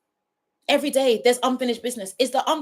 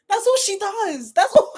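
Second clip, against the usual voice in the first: real laughing fit, voice climbing high; crying with laughter; 9 semitones higher than usual. Real, not acted. Reviewer telling viewers she's crying, helpless laughter. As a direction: squeal the words out between laughs. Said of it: "Guys, I'm crying".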